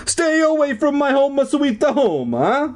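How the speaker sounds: a silly mock phantom threat; harsh phantom whisper. Phantom threatens Brooke, a hammed-up high ghostly warning. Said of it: "whispered harshly in my ear".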